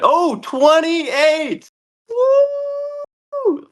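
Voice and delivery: high, thrilled cheer; excited whoop. Real, not acted. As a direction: whoop with sudden delight. Big moment in the game.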